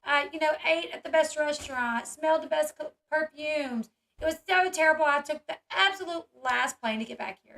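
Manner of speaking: a hammed-up posh complaint about luxury; mock-tragic socialite whine; mocking Barbara Walters. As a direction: parody her fake suffering, with some lift. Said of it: "She was like".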